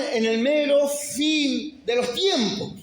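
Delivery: slow, high preaching line; measured preaching. Acted. Pastor warning the church of the end times, slow preaching. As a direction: lay it down slowly before the build.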